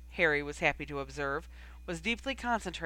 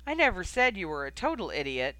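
ordinary delivery, nothing marked

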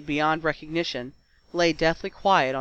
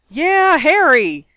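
high, loud, drawn-out whoop; yelled cheer; 9 semitones higher, 11 dB louder, slower than usual. A narrator's reading. Ron jumping at Harry's catch, jubilant yell. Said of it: "yelled Ron".

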